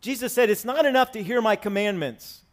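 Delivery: usual speaking voice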